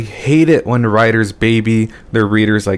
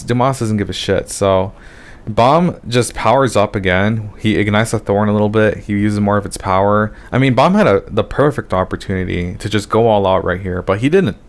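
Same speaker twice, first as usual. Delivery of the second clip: usual speaking voice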